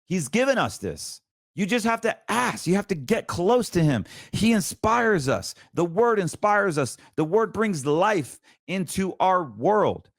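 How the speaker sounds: high, ringing preacher's voice held through every line; fiery preaching. Acted. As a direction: preach it out to the congregation with fire. Preaching at full force.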